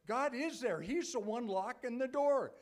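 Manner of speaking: ordinary talk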